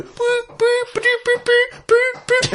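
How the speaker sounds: very high piping whistle noises; imitated football whistles. Copying the game's whistles for friends, very high piping toots. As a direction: toot like a referee's whistle. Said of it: "football whistles going".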